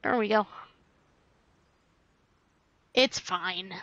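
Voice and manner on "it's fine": ordinary talk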